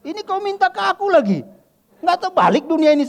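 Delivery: put-on high, loud Batak-accented protest; mock outrage in a Batak accent. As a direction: pastor mock-scolding the friend in a Batak accent. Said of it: "Saya pakai logat, logat, logat Batak pula kan".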